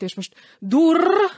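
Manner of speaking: high, loud, slow "és most durr"; excited shout. Acted. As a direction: call out the bang with delight. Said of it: "kiáltottam". (lelkes kiáltás)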